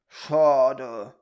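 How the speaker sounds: loud, drawn-out growly "Schade"; disappointed grumble. A narrator's reading. Peter and Teddy can take nothing home, grumbling. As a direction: grumble it out, let down. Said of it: "brummten Peter und sein Teddy".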